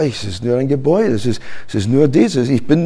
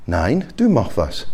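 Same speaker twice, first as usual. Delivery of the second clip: lower, quiet, firm reply; God's calm answer; 5 semitones lower, 7 dB quieter than usual. Acted. God answering the complainer, quiet and firm. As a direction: hand the task back calmly. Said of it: "Und was sagt Gott?".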